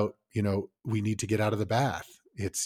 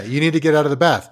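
acted parent shout; 2 semitones higher, 8 dB louder, faster than usual. Acted parent-kid bath standoff.

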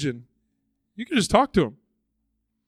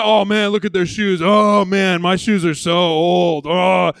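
mock envious moaning; 3 semitones higher, 9 dB louder, slower than usual. Mimicking envy over shoes.

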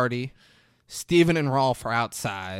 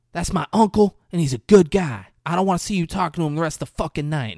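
mock angry warning; 6 semitones higher, 5 dB louder, faster than usual. Retold threat at party.

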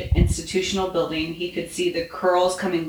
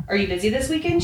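higher-pitched, lilting question; light invitation; 7 semitones higher, faster than usual. A narrator's reading. Woman fussing over her bouquet, brightly inviting. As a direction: lift the question up high.

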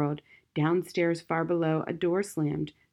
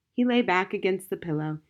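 plain reading voice

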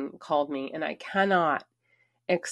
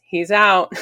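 much louder, bright and triumphant call; cheerful announcement; 2 semitones higher, 12 dB louder than usual. Retold or imitated. Police officer telling her the boyfriend is gone, loud and cheerful. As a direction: sing it out like good news.

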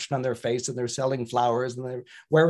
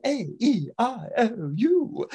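careful proper vowels; 7 semitones higher, slower than usual. Shown as a demonstration. Vowels now correct.

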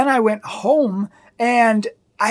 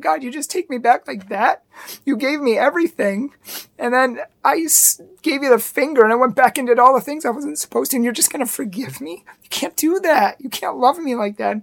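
choked up, pleading with God; moved close to tears, pleading; emotional; 3 semitones higher than usual. Real, not acted. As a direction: voice thick with real emotion, pitched up. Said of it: "not usually this emotional".

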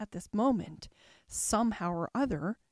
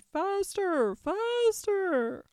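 high-pitched urging call; crying out; 9 semitones higher, 4 dB louder, much slower than usual. A narrator's reading. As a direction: drive a runner on with high cries. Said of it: "still the queen cried".

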